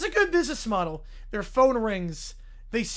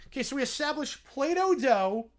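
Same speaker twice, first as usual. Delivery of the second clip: usual speaking voice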